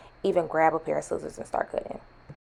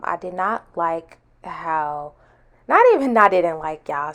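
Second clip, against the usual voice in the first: ordinary talk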